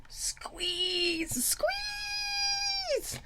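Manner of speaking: high, squeaky stretched-out squeeze. Acted. Hugging her viewers through the screen, high squeaky squeal. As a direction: squeal it like a tight hug. Acting out giving a hug.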